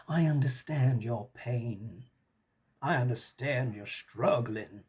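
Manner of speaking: put-on slow, light Southern accent; a slow Southern drawl for a politician soothing voters. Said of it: "I did that in a Southern accent".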